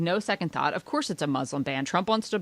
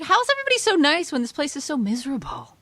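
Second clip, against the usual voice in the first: incredulous; 9 semitones higher, 4 dB louder than usual. Retold or imitated. Incredulous quote about Minnesotans.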